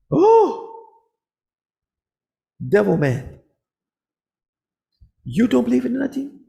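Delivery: shrill, loud, high gasp of horror; shocked outcry. Retold or imitated. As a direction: cry it out scandalised.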